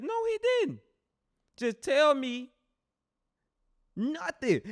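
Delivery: very high, loud comic disbelief; mock outraged squeal. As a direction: squeal it like you can't believe it. Retelling his own outraged reaction.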